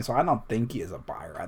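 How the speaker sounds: quick hushed whisper. Acted. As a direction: whisper it fast as an aside. Whispered aside in character.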